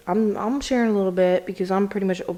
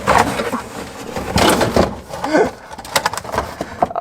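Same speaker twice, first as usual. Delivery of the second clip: airy rising laugh while fumbling; breathy laugh; 8 semitones higher, 4 dB louder than usual. Real, not acted. Laughing while struggling one-handed.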